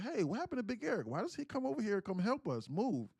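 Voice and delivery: high, fast imitation of his mother, slowing at the end; mom's voice. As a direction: nag in a high mom voice, then drag out the last words. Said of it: "She's like".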